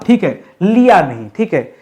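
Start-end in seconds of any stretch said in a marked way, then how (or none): none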